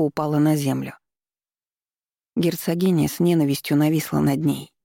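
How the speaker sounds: ordinary delivery, nothing marked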